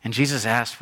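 ordinary delivery

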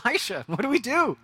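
high, panicky plea to his master; crying out for help. Retold or imitated. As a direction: beg for help in mock panic. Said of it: "He cried out to his friend".

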